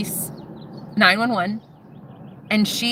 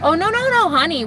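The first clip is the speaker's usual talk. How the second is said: exaggerated patronizing "honey"; mock-sweet refusal; 8 semitones higher than usual. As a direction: swoop up high in scornful refusal. Acting out the woman's scornful refusal.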